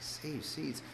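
hushed, puzzled echo; baffled aside. Retold or imitated. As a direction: repeat it very softly, in disbelief. Baffled reaction to friend.

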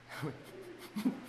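a high, breathless burst of laughter with no voice. Real, not acted. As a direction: laugh in disbelief without sound. Stunned by "neck up" answer.

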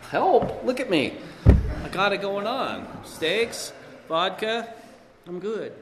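high, loud mock swagger; cocky bravado. Speaker mocks his younger self bragging about steaks and vodka, cocky and high. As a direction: play the drunk who thinks he's fine.

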